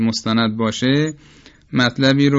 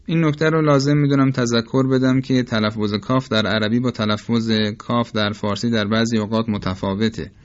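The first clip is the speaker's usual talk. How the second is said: ordinary talk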